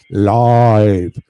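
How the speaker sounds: low, loud, drawn-out word; emphatic retort. Retold or imitated. Man tells watcher he is broadcasting, emphatic retort. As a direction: boom it out low and long.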